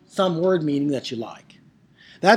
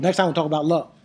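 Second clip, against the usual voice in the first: ordinary delivery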